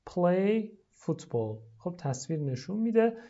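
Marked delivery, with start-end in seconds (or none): 0.1-1.6 very slow teacher model; deliberate classroom drill; slow model phrase. Shown as a demonstration. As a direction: draw the words out to copy. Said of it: "لطفاً تکرار کنید".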